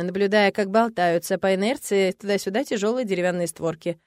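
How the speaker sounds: plain reading voice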